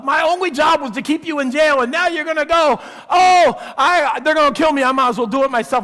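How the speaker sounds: high, loud, frantic cry; the jailer despairing as the prisoners go free; panicking jailer. Acted. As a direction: blurt it out in rising panic.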